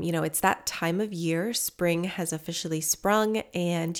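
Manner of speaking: usual speaking voice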